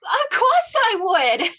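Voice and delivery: high, breathless yes after a gasp; overjoyed yes. Acted. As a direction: burst out with joy. Accepting a proposal, overjoyed.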